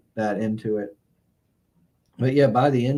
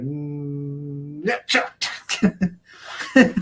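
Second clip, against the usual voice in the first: mock dismissive; 2 semitones higher, much slower than usual. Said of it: "and I'm like".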